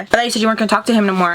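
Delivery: fast jealous accusation. Retold or imitated. Jealous boyfriend questioning her.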